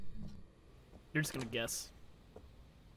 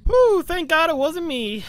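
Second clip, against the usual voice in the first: relieved exclaim; 11 semitones higher, 12 dB louder, slower than usual. Real, not acted. Teammate just died in hunt.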